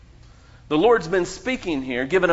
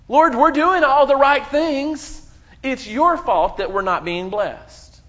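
mock complaint; 7 semitones higher, 6 dB louder than usual. Voicing complaining Israelites to God.

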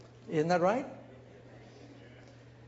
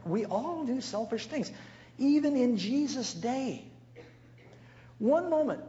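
preaching emphasis; 8 semitones higher than usual. Real, not acted. Preacher stressing a point to the church, rising emphasis. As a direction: lift the congregation.

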